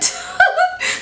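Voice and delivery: high, light giggle; laugh. Real, not acted. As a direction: giggle at your own joke. Laughs at own joke about flies.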